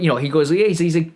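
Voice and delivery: usual speaking voice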